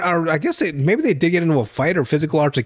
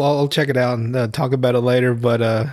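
usual speaking voice

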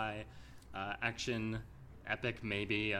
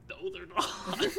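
laughing; 7 semitones higher, slower than usual. Retold or imitated. Voicing the real world's reply.

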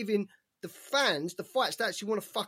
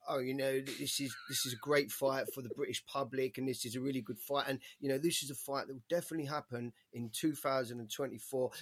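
a low, soft, droning impression of the promoter; imitating the promoter, lower and softer; mock promoter answer; 8 semitones lower, 7 dB quieter than usual. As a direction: lightly send up his careful PR answer. Mocking the promoter's interview answer.

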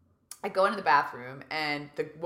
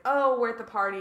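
higher, louder mock party-girl quote; party exclamation; 6 semitones higher, 7 dB louder than usual. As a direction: act out arriving at the party.

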